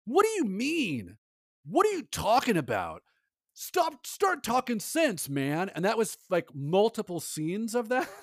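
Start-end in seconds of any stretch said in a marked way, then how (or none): 0.0-5.7 mildly hammed-up incredulous movie character; high-pitched imitation of a baffled hero; mock exasperated demands. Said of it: "he kept just saying".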